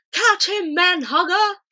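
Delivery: high, loud game-play shout; giant shouting. A narrator's reading. Flesh Lump Eater tossing the BFG, loud high shout. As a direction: fling the call across the field.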